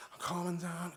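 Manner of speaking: ordinary delivery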